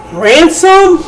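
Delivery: high incredulous "Ransom?" thrown back; mocking the wrong word. Shown as a demonstration. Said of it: "the word is rant".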